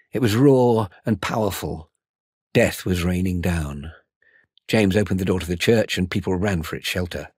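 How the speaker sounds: usual speaking voice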